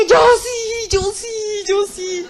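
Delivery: loud, high shout breaking into tears; crying shout. Retold or imitated. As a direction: cry out desperately, fading a little on each repeat. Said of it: "Y yo grité".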